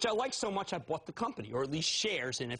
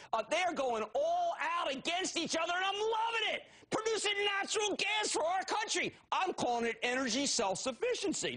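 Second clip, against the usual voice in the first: excited shout; 12 semitones higher than usual. Real, not acted. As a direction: whip the audience up. Host hyping gas drillers.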